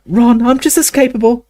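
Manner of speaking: put-on high, indignant girl; protest, high and offended; young girl voice. Hermione protests, cut off.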